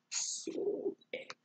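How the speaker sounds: ordinary delivery, nothing marked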